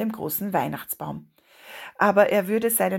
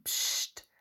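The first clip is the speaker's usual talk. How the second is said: soft unvoiced shush; 8 dB quieter than usual. A narrator's reading. Older boy silences Lasse inside the tree, soft shush. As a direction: hush him without a sound. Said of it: "machte er".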